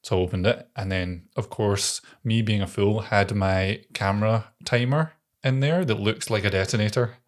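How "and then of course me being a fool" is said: ordinary delivery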